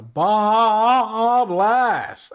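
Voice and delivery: huge, drawn-out high holler; meme catchphrase shout. Acted. Streamer quoting a meme while listing an order, drawn-out shout. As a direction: belt it out as a meme catchphrase, stretching the first word. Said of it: "I have to say it like that every time".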